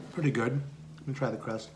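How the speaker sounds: humming with closed lips; talking between bites; mouth full, chewing. Real, not acted. Seen 4: Pursed lips and full cheeks mid-chew while humming; bites just before.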